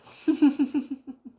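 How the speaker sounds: loud, high burst of laughter. Real, not acted. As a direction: laugh out loud at your own story. Laughs after quoting herself.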